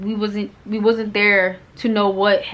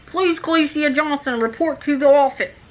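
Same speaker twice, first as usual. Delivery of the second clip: mock principal over the intercom, pitched high; intercom announcement; 6 semitones higher than usual. Principal paging a student to the office, mock announcement voice. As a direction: call it out like a school announcement. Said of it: "principal or whatever talking on the intercom".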